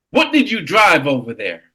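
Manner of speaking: ordinary talk, nothing marked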